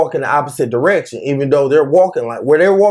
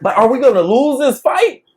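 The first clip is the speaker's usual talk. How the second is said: laughing disbelief; 6 semitones higher than usual. Retold or imitated. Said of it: "I was like".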